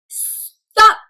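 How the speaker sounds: loud, high "stop"; commanding stop. Shown as a demonstration. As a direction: call out a sudden halt. Period calls out stop.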